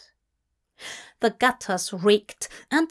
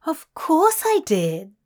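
higher, airy matter-of-fact reply; breezy, as if it's obvious; 6 semitones higher, 3 dB louder than usual. Acted. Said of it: "as if it were obvious".

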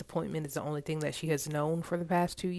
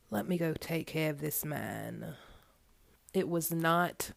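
ordinary delivery

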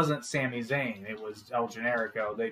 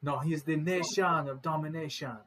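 put-on Nigerian accent, slightly higher; hammed-up attempt at a Nigerian accent; bad Nigerian accent; 3 semitones higher than usual. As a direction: sad stab at sounding Nigerian. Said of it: "my extremely sad attempt at a Nigerian accent".

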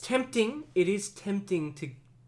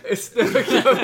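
laughing; 9 semitones higher, 10 dB louder than usual. Real, not acted. Laughing echo.

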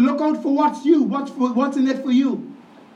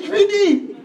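calling out from the sidelines; 8 semitones higher than usual. Retold or imitated. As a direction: shout it across the court to his son. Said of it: "I said".

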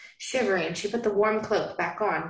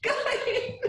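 laughing. Real, not acted. Laughing over a silly word.

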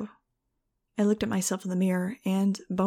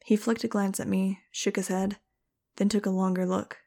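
ordinary delivery

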